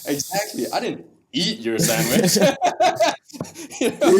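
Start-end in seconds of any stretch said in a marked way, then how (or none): 0.7-2.4 "eat" punched loud, breaking into laughter; stress on EAT. Shown as a demonstration. Joining the sandwich example, laughing stress on "eat". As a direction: hit "eat" hard, amused. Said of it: "you can do that with everything".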